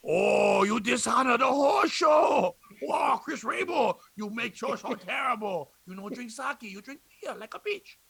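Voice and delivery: high, drawn-out wailing caricature; exaggerated character impression. Scolding Chris for dishonoring the show, hammed-up impression. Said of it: "Those are my two impressions".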